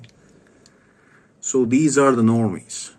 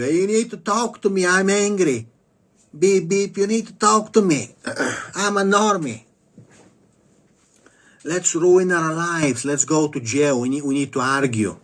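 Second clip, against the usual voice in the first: high mocking road-rage voice; angry normie; 6 semitones higher than usual. To the viewer, mocking an angry driver who wants to argue, high whiny voice. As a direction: whine like a petty road-rager, pitched high. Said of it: "they wanna talk to you".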